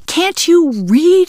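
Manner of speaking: high, slow, exasperated outburst; blurted. Acted. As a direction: blurt it out in disbelief. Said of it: "she blurted".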